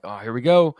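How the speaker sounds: a pumped-up little exclamation; excited. Retold or imitated. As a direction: burst out eagerly, ready to get going. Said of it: "I'm excited to come back".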